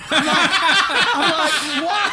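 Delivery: high, loud, helpless laughter through the words; laughing. Real, not acted. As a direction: crack up while acting out disbelief. Cracking up telling the story.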